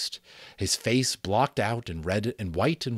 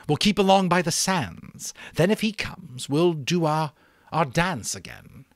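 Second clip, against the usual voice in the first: higher, louder rousing call; rallying chief; 9 semitones higher, 6 dB louder than usual. Acted. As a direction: rouse the tribe with promise of meat. Chief explains raid plan.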